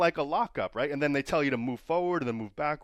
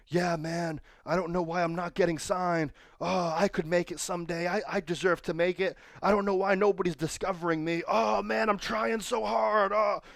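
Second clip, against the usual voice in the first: higher, mock self-pitying whine building to a wail; whiny complaining; 5 semitones higher than usual. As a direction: whine like a wrestler sulking that nobody signs him. Mocking his whiny younger self.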